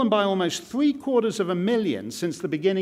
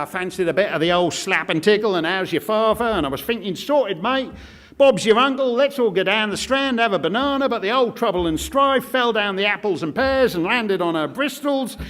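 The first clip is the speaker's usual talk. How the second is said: hammed-up Cockney, higher and louder toward the end; mock Cockney; 3 semitones higher, 3 dB louder than usual. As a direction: parody a Cockney soap character gabbling rhyming slang. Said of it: "it's all about how".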